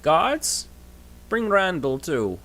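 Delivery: high, loud command; calling the guards. Acted. Giant king calling his guards, commanding. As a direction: bark an order to the guards.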